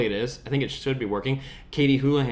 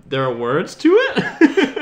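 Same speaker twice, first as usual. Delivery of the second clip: loud, higher laughing disbelief; 8 semitones higher, 7 dB louder, much slower than usual. Real, not acted. Asking co-host about the song, cracking up. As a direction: ask it through real laughter.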